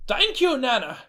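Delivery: a hint of a stunned grandson; stammered thanks as the pretend grandson; young man's voice. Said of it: "he said".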